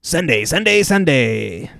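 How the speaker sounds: booming announcer yelling Sunday; monster truck ad. Pretend. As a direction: hype it like a monster truck rally ad. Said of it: "Can you do a monster truck voice?".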